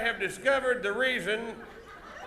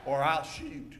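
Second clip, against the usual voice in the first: low, softer warning after the yell; stage hero's threat; 8 semitones lower, 5 dB quieter than usual. Shown as a demonstration. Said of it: "yell out".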